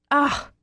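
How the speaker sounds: a sharp, high, loud frustrated "Ah!"; frustrated cry. Acted. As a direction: burst out in exasperation. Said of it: "I cry".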